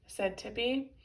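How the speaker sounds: usual speaking voice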